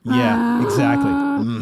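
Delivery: very high, loud hammed-up mm-hmm; mock Morgan Freeman. Friends joking about Shawshank, hammed-up high Freeman agreement. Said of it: "Morgan Freeman just goes".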